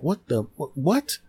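shocked stammer. Retold or imitated. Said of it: "he stood there shocked".

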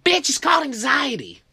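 high, loud exasperated yell; frustrated mom. Acted. Mom correcting panicking kid, exasperated shout. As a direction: snap back at full volume. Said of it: "She's frustrated".

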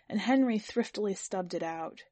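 plain reading voice

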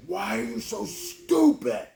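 high, slow strained self-scolding; frustrated rage. Retold or imitated. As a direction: wail at yourself in acted frustration. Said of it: "and frustration and rage".

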